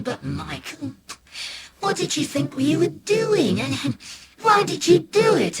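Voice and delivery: high, puzzled mum voice; baffled mum. Acted. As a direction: ask in disbelief. Mum questions son, baffled.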